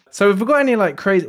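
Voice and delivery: usual speaking voice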